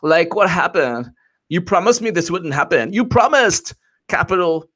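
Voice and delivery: high-pitched mock Valley girl whine. FBI employee complaining after the election, Valley girl. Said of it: "Valley girls from, from the Valley".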